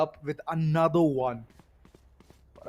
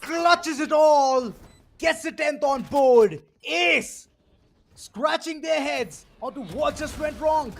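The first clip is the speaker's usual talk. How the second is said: shouted, high and slow hype call; esports caster; 10 semitones higher, slower than usual. Real, not acted. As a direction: yell it out as the round ends. Clutch round, caster erupts.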